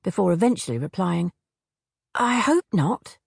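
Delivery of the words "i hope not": bewildered. A narrator's reading. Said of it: "completely bewildered".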